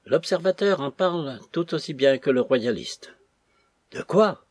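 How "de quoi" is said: high, loud, alarmed question; sudden raised-pitch outburst; startled exclamation. A narrator's reading. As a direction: cry it out, caught off guard. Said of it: "S'écria Rawdon, rouge jusqu'aux oreilles".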